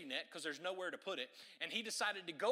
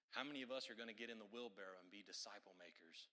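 ordinary talk

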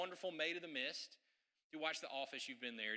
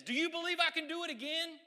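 high, loud challenge to the crowd; big showman call, pitched high; showman shout; 12 semitones higher, 8 dB louder than usual. Retold or imitated. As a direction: call it out to a hundred thousand people.

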